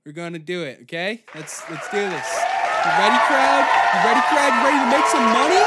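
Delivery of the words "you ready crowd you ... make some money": loud, high hype-man yelling; crowd hype shout. Acted. Host yelling to hype the crowd. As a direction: pump up the crowd at full volume.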